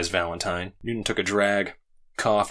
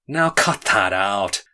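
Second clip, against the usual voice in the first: slow, louder, each word pressed; firm objection, slow and louder; emphatically; 6 dB louder, slower than usual. A narrator's reading. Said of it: "said Noonan emphatically".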